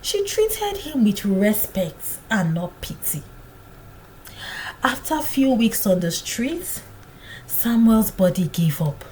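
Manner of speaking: usual speaking voice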